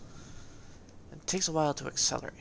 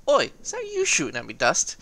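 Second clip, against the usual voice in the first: mock indignant challenge; 9 dB louder than usual. Player to friend Dust who fired on him, mock indignant shout. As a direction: confront a mate with playful outrage.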